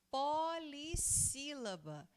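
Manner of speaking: slow, stretched syllables; drawn-out term. Shown as a demonstration. Teacher introducing the next word class to pupils, slowly drawn out. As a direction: stretch out each part of "Polissílaba".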